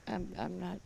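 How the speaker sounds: ordinary talk, nothing marked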